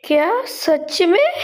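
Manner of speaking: high, slow astonished question; happy disbelief. Acted. Wife hearing her friends are coming back, amazed.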